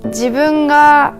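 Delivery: normal talking voice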